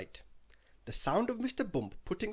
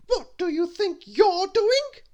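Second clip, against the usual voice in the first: much higher, louder, slow bossy shout; Little Miss Bossy telling off the bear, shouting; bossy yell; 12 dB louder, slower than usual. Acted. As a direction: scold the bear at full volume. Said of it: "yelled Little Miss Bossy".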